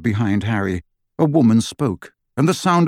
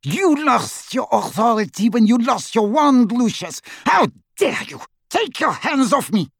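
furious; 12 semitones higher than usual. Acted. Bellatrix furious, wrestling free.

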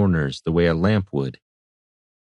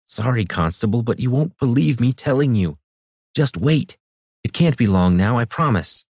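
higher, nervous timid man; an anxious cobbler pleading to be believed; 5 semitones higher than usual. A narrator's reading. Voices timid cobbler Lyerson.

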